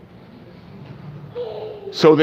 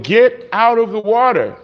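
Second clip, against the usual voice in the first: higher-pitched call across the water; yelling from the boat; 6 semitones higher than usual. Acted. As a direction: call out to the swimmer. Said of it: "are yelling to him".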